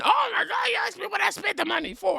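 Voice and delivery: very high, loud mocking shriek of outrage; mock hysterical shriek. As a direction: ham up a hysterical screaming activist. Mimicking hysterical activist response.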